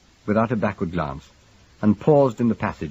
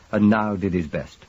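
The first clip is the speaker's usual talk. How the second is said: plain reading voice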